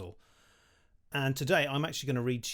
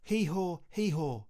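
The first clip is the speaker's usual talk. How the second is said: breezy and pleased with himself; wizard Perlin, lightly voiced; 2 semitones higher than usual. A narrator's reading. Wizard explains the update to Louis, breezy and lightly voiced. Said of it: "emailed Perlin".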